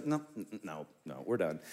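low, quiet, final pilot refusal; mock pilot cutting them off; curt pilot impression. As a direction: shut it down like the pilot, low and flat-out. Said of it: "And he goes".

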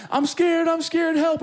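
high-pitched mock cry for help; acted frightened kid; imitated screaming child. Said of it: "he starts screaming".

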